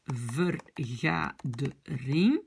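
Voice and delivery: Vergadering said very slowly for learners; drawn out, one syllable at a time; slow model word. Shown as a demonstration. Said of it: "model word".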